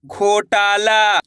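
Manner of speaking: दरोगा drawn out very slowly; teacher blending syllables slowly for kids; slowly blended word. Shown as a demonstration. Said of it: "घो टा ला".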